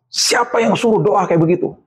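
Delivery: high-pitched retold scolding; padre enfadado imitado. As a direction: imitate an outraged father demanding an answer. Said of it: "dia teriak sama saya".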